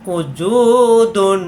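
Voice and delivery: উজুদুন with জু drawn out slowly; stretched vowel. Shown as a demonstration. Teacher showing the long জু in উজুদুন. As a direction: lengthen the জু in উজুদুন. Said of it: "জুকে লম্বা করতে হবে".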